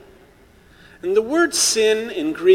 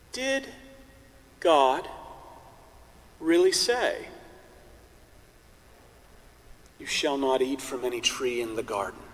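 whisper; 5 semitones lower, 5 dB quieter than usual. Acted. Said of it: "And he whispers into their ear".

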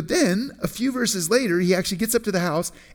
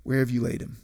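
low, subdued question, softly retold; quietly troubled, imitating grief; deeply moved; 6 semitones lower, 5 dB quieter, faster than usual. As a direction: barely get the question out. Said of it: "all he could get out of his mouth was".

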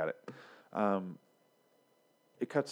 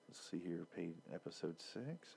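reading to himself; 3 semitones lower, 15 dB quieter than usual. Retold or imitated. Tobias checking the script's episodes, murmuring to himself. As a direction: murmur along while looking something up on a page. Imagined flipping through the script.

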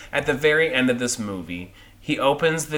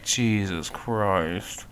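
imagined appalled reaction; 6 semitones lower, 9 dB quieter, much slower than usual. Retold or imitated. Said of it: "she was like".

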